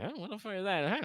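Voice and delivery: high, shocked outburst; startled. Acted. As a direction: jump at a strange noise. Startled bystanders react.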